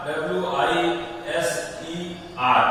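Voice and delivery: each letter drawn out for the class; spelled out slowly. Shown as a demonstration. Teacher spelling the word for pupils, slow and deliberate. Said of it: "teacher spells the word out".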